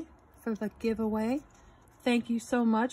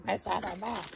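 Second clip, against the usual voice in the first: softer repeat of the mimicked name; quiet catchphrase imitation; imitating Miss Southern Belle; 3 semitones lower, 5 dB quieter, faster than usual. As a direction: say the name softly as its owner would. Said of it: "As you would say".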